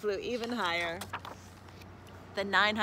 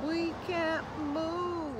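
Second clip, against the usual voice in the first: put-on higher, drawn-out young frog voice; young frog calling; 7 semitones higher, much slower than usual. A narrator's reading. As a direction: call out slowly as a squashed little frog. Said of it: "one called".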